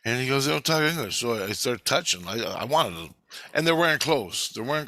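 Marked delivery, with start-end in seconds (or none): 0.5-1.2 higher pitch, falling firmly at the end; retold matter-of-fact repeat, voice raised in pitch; insistent answer. Handler answers the puzzled dreamer, insistent.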